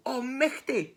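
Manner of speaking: high storybook alarm; startled owl. Acted. Hoolet catching sight of gruffalo, high startled cry. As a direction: exclaim it in fright.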